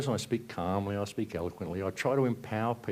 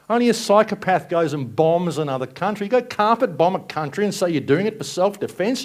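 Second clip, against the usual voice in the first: high, loud furious yelling at politicians; angry rant; 11 semitones higher, 7 dB louder than usual. Real, not acted. Speaker raging at governments over bombed kids, real furious shouting. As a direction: really let the rage out. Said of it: "I'm angry".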